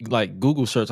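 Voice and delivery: ordinary talk, nothing marked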